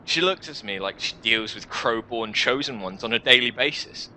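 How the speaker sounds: normal talking voice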